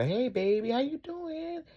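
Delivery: very high sing-song imitation of greeting a daughter; sweet greeting. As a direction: coo it way up high, as to a child. Voices usual greeting to daughter.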